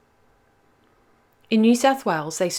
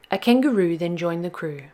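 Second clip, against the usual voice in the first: plain reading voice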